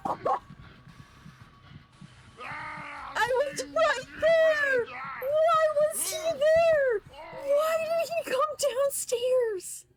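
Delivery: high, slow, despairing shout; anguished wail. Real, not acted. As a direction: wail in disbelief at dying. Dies in game, wailing.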